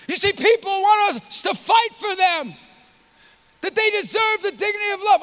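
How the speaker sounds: high, fervent sermon voice; impassioned preaching. Real, not acted. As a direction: preach it with rising urgency, pitched high. Preaches at full force.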